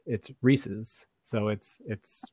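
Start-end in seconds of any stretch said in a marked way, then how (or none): 0.4-1.0 "Reese's" as REE-siz; correct pronunciation. Shown as a demonstration. Concluding from inventor's name, REE-siz. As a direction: say "Reese's" with a short -iz ending. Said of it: "so it's, it's Reese".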